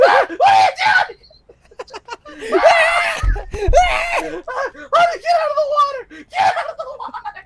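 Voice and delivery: very high, loud screech through laughter; panicked mock shriek. Mother screaming at husband to get out, high panicked shriek. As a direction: shriek for him to leave the water.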